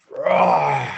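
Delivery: loud, low lion roar. Acted. Lion roaring at the cub, loud and low. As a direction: let out a big deep roar. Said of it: "looks and he roars".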